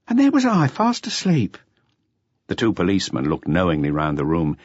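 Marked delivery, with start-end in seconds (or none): 0.1-1.6 self-pitying dancer. A narrator's reading. Adele's voice continues.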